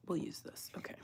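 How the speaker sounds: unvoiced whisper; whisper to self. Real, not acted. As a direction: murmur the choice privately. Whispering to herself.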